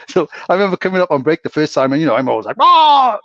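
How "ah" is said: high, loud playful holler; loud yell. Retold or imitated. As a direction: let out a big high "aah". Re-enacting his usual loud entrance.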